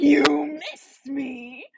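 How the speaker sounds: stretched-out, incredulous "You miss me?"; slow disbelieving echo. Retold or imitated. Imagining her own reply to Colin, slow and incredulous. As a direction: drag it out in disbelief. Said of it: "I would be like".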